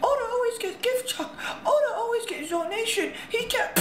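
high, squeaky mock of a hater's comment; sneering parody of a commenter. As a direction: read the comment like a whiny kid. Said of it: "I'm reading some comments".

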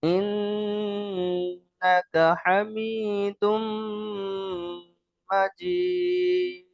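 slow chant with long held nasal m and n; Quranic-style recitation. Shown as a demonstration. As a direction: recite slowly for learners, holding each long sound. (ধীরে টেনে সুরেলা তিলাওয়াত)

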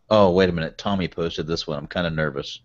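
ordinary delivery

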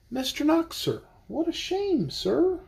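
a fussy little character complaining; Mister Knox. Acted. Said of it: "Mister Knox's lines in the book".

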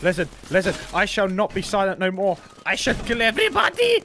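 lightly hammed-up declaration, climbing high; mock grand proclamation. Player vowing to the group to kill all, mock proclamation. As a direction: build to a high, loud playful threat.

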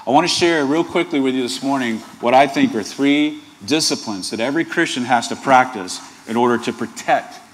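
ordinary delivery

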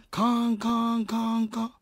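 imitated railroad crossing bell clang; lightly imitated crossing bell. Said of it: "一番怖かったんが".

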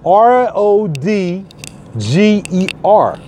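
slow, letter by letter; slow spelled-out letters; spelling it out. Shown as a demonstration. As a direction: spell each letter out slowly. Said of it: "Go to my Facebook page".

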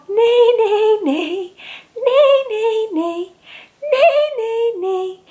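high whinnying neigh; horse neighs. Acted. Reading to a child, whinnying like a horse.

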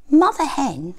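plain reading voice

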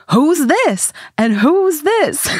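high, slow accusing demand; confrontational. Retold or imitated. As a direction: demand it, suspicious and sharp. Snatching phone, confronting.

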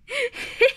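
high squealing giggle; piglet giggle. Acted. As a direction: let out a tiny piggy giggle. George laughing.

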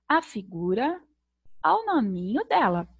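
ordinary delivery

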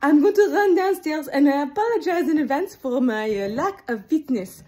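usual speaking voice

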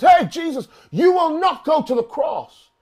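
shouted high, forbidding; shouted rebuke. Retold or imitated. Peter shouting at Jesus to forbid the cross. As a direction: yell it up at Jesus to stop him. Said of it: "he says".

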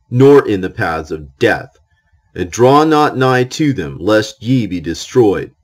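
normal talking voice

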